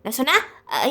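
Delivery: normal talking voice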